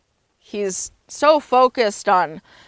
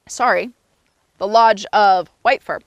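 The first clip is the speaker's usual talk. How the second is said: plain reading voice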